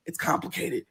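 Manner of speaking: hushed repeat; soft exhausted whisper. Retold or imitated. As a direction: breathe it out, pleading.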